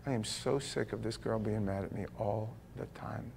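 a hint of weary sighing husband; quiet weary complaint. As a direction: mutter it tired and fed up. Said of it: "some of you guys are like".

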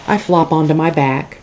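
ordinary delivery, nothing marked

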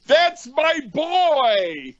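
high, drawn-out triumphant shout; proud dad. Retold or imitated. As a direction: crow it slowly as a proud father. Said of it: "He was proud of him".

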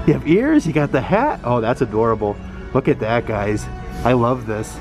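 usual speaking voice

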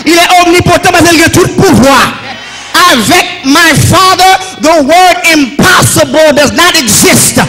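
high, ringing declamation with long-held phrase ends; full-force preaching. Real, not acted. Preacher extols his Father to the congregation, full-force preaching. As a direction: proclaim each phrase to the whole congregation.

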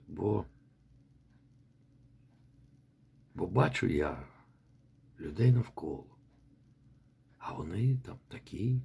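a quiet plea to God, sinking to a whisper; hushed personal prayer, nearly whispered at the end. Shown as a demonstration. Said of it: "це може бути".

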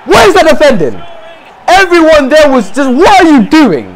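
angry disbelief; exasperated shouting. Real, not acted. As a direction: demand an explanation from useless teammates. Berating his defenders.

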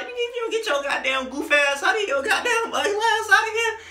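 high-pitched, hammed-up telling-off; mock scolding. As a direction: shoo him away in a high, laughing pitch. Acts mocking reply to gun noises.

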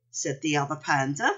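plain reading voice

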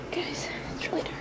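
hushed whisper in the dark. Real, not acted. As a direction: whisper it, a little scared. Dark room, scared.

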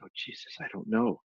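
breathy whispered admission; whispered, half-laughing confession. Retold or imitated. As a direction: drop to a whisper, sheepish. Retells blank, panicked answer.

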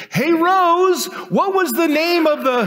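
high, loud holler across the house; drawn-out, high-pitched call; calling to wife. Acted. Joke: husband calls to wife.

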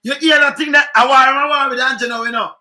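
loud, worked-up reaction; excited outburst. Real, not acted. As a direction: fire it out, riled up.

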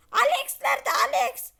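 loud call for Alex. Pretend. Robot calls loudly for missing Alex. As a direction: shout for the missing friend.

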